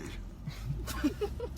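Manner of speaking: soft, high voiceless chuckle; mocking chuckle. Real, not acted. Taunting a rival about making them normal, quiet high chuckle. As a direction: snicker quietly to yourself. Mocking laugh after a threat.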